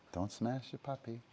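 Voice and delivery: quiet aside to the dog; soft repeat. Real, not acted. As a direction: say it again under your breath. Repeats the warning more softly.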